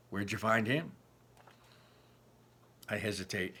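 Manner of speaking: ordinary delivery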